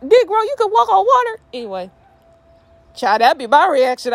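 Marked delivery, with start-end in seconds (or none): 0.0-1.4 very high, incredulous question; high-pitched, hammed-up double take; comic disbelief. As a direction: react in mock astonishment. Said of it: "Looking like".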